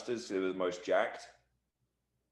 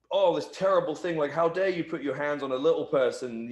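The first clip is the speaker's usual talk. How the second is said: mock outrage; 6 semitones higher, 4 dB louder than usual. Mock outrage heat idea.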